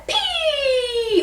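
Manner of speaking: high, loud, long pee; highest head voice. Shown as a demonstration. Teacher demonstrates head voice, high squeal. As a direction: squeal it from the eyes. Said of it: "highest head voice".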